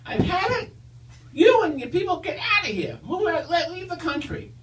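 high squeaky Stooges voice; Curly voice. Acted. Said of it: "high-pitched voice that sounds a little bit like Curly from the Three Stooges".

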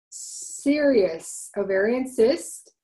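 usual speaking voice

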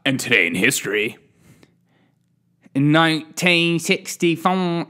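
mock English accent for the date; playful English accent. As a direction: announce it like a plummy Brit. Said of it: "I do an English accent every time for a date".

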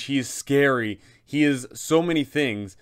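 ordinary talk, nothing marked

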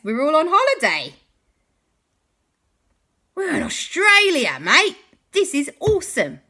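gleeful, cheerful holiday shout; excited. Acted. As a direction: cheer it like a delighted tourist. Characters celebrate arriving in Australia.

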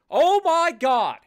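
slow stunned loud gasp; amazed disbelief; surprised. Real, not acted. Big donation surprise.

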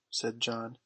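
plain reading voice

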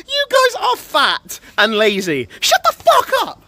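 very high squealed insult; rising to a piercingly high yell; high-pitched outburst. Acted. Lashing out at the chat.

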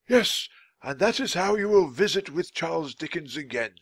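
a hint of a magician explaining; lightly voiced wise wizard. A narrator's reading. Merlin tells the children how to visit Dickens, lightly voiced wizard.

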